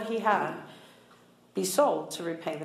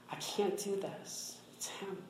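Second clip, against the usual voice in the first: hushed prayer; 11 dB quieter, slower than usual. Retold or imitated. Quoted prayer on her knees.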